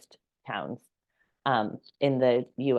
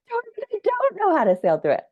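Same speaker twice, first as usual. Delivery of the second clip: panicked, much higher pitch; a squeaky overwhelmed wail; panicky high whine; 4 dB louder than usual. Pretend. Telling her viewers how she'll sound when overwhelmed, a high panicked whine. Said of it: "Next time I feel like".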